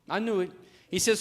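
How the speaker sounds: ordinary talk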